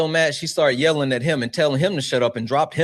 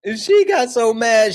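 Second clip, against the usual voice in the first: incredulous build-up; 11 semitones higher, 6 dB louder, slower than usual. Real, not acted. Retelling her anger, laughing.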